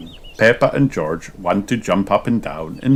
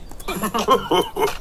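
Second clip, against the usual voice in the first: chuckle; 13 semitones higher than usual. A narrator's reading.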